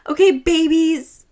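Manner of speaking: loud drawn-out mocking call; screamed taunt. Retelling how a drunk-sounding woman heckled them, loud mocking call. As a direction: imitate a woman hollering, stretching every word. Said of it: "She just kept screaming that we were babies".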